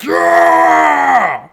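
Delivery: a god roaring approval from Valhalla; Odin cheering. Retold or imitated. Streamer voicing Odin welcoming the soldier, loud cheer. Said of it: "Odin in Valhalla's like".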